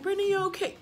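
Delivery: high-pitched fussing concern; cooing check-in, mimicked; concerned classmate. Classmates fussing over crying teller.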